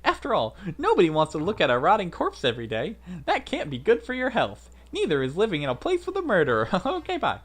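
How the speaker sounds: high, loud mocking villain voice; gleeful taunting villain. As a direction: taunt them brightly, high and quick.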